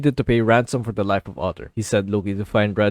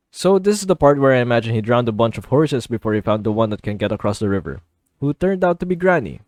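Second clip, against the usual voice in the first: ordinary delivery, nothing marked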